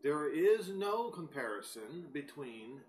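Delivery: ordinary delivery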